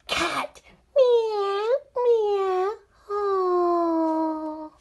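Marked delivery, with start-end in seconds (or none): 1.0-2.7 high, drawn-out playful meow; cat meow. Pretend. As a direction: imitate a mewing cat. Imitates a cat after saying cat.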